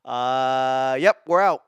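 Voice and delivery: mock brain voice calling it quits, loud; brain giving up. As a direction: play your brain cheerfully clocking out. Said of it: "my brain just said".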